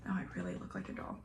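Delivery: usual speaking voice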